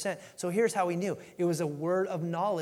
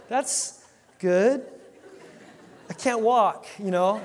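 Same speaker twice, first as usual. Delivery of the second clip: laughing through a wry complaint; 2 semitones higher, 3 dB louder, much slower than usual. Retold or imitated. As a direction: chuckle it as a joke. Paralytic's reply, laughing.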